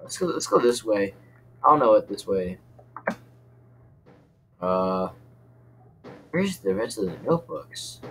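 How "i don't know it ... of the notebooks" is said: ordinary talk, nothing marked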